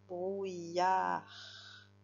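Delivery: "Brouillard" stretched out as brou-i-yar; syllable by syllable. Shown as a demonstration. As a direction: sound out "Brouillard" slowly, lingering on yar. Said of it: "la deuxième syllabe".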